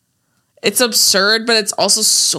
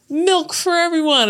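Peter announcing; 10 semitones higher than usual. Retold or imitated. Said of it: "And he's like".

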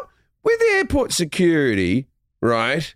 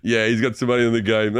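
lower, unbothered delivery; chill kid; 6 semitones lower than usual. Retold or imitated. Retelling the kid's reaction to a strike, chill. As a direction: toss it off coolly, as if unimpressed. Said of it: "I like that he was chill and just went".

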